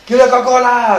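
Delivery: high, loud demanding yell; child yelling. Retold or imitated. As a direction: scream the order like a spoiled kid. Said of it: "Así".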